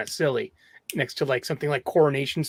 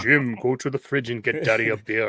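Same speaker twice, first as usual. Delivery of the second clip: Darth Vader voice; 4 semitones lower than usual. Retold or imitated. Vader voice joke.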